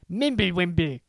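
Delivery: ordinary delivery, nothing marked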